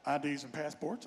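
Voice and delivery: low, quiet official voice; border agent. Retold or imitated. Agent at the crossing demanding documents, low and curt.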